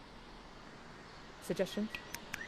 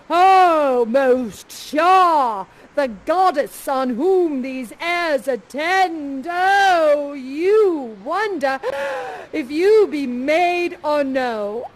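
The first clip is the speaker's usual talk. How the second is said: louder, slow, grand courtly declaration; thunderstruck Ferdinand; 7 dB louder, much slower than usual. Acted. Ferdinand seeing Miranda for the first time, grandly awed. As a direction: proclaim it like a dazzled prince. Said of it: "and you say".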